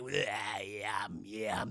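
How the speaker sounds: very low, soft monster voice; deep gremlin-like eating voice; creature voice. Retold or imitated. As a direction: play a lurking little monster, low and quiet. Voicing the creature.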